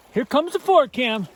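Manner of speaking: excited shout. Real, not acted. Entering icy stream.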